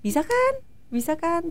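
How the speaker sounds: high-pitched approving encouragement; Adi. Retold or imitated. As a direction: praise them with a lifted, coaxing question. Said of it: "mengapresiasi itu". (memuji dengan nada tinggi, menyemangati)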